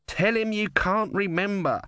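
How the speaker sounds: high, urgent yell at no great volume; mock yell. A narrator's reading. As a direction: urge it in a high, held-back yell. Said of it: "Om yelled".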